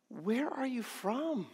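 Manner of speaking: a worried governor, half to himself; troubled Pilate. Acted. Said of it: "the question that's plaguing his heart".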